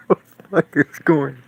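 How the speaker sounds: high, loud, cracking up in disbelief; laughing outburst. Real, not acted. Reacting to the weird actor on video, loud laughing disbelief. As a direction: burst out laughing as you yell it.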